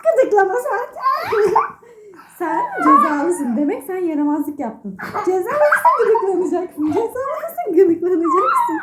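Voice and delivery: high, laughing mock scolding; playful tickling threat. Mother tickling child, mock scolding.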